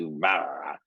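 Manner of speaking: low gruff growl, briefly; a quick gruff rasp like the actor; raspy voice. Retold or imitated. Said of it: "he talks like this, like a raspy".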